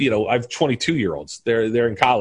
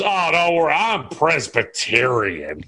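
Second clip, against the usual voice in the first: slow, drawn-out tipsy delivery; drinking movie character; 2 semitones higher, slower than usual. Acted. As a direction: stretch the words out like a man in drink. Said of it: "He's drinking".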